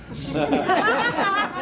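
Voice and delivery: a high burst of laughter at his own joke. Real, not acted. Laughs at his failed business.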